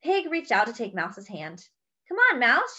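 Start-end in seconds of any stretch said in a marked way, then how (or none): 2.1-2.8 lightly higher, eager little pig; a hint of a pig calling her friend; Pig's voice. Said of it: "she said".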